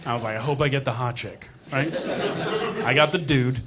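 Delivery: usual speaking voice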